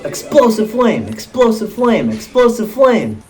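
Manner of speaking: high mimicked attack call, each one falling; Palutena. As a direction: chant the game move like a character's catchphrase. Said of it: "Palutena is gonna go".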